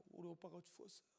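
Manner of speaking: very soft, understated grief; tearful. Acted. Sisters telling of their brother's death, mildly tearful. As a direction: voice the weeping sisters in a hushed tone. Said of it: "마리아 마르다 웁니다".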